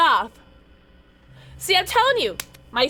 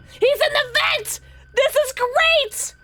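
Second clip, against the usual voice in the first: excited screaming; 10 semitones higher than usual. Real, not acted. Streamer cheering to chat that the monster is in the vent, high excited screaming. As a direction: yell the good news, thrilled. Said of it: "I know I'm screaming".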